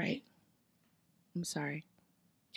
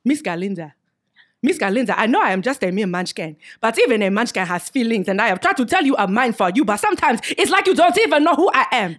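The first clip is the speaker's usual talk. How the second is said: put-on louder, rising African accent reading; 5 dB louder than usual. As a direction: play Boq in an African accent. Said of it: "pronounce this like you would in a different accent".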